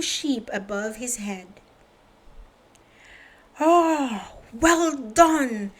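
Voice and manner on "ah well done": higher farmer voice, relieved; pleased farmer. Acted. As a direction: praise him with relief. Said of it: "said Farmer Fields".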